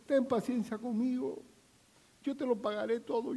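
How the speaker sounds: high, soft desperate plea; hammed-up begging for patience; begging servant. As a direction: plead on your knees.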